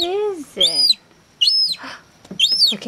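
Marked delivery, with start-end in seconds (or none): 2.7-2.9 a sharp gasp, then a high, surprised look; startled, delighted gasp. Real, not acted. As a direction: gasp in delight at the moving thing. Sees the pet move, gasps.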